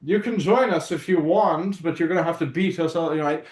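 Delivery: high, loud mock-posh taunt; posh snob. Posh fighters inviting him to join, hammed-up haughty taunt. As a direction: taunt them like a snooty rival. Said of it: "And they're like".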